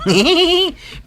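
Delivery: high laugh at the joke. Acted. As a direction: burst out laughing. Laughing at a story.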